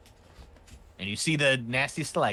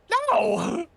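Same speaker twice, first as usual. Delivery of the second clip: high, loud wailed "No!"; loud, high-pitched mock wail; victim's cry; 9 dB louder than usual. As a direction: cry out no as if being eaten. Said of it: "And you just hear".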